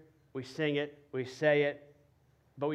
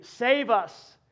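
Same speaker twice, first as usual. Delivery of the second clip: crowd cry; 7 semitones higher, 8 dB louder than usual. Retold or imitated. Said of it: "They were saying".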